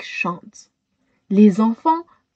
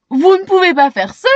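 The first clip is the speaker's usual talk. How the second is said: shocked protest; 6 semitones higher, 4 dB louder, much faster than usual. A narrator's reading.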